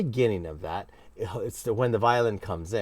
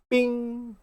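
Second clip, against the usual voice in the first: mock piano ding; 13 semitones higher than usual. Imitating the piano's ready tone.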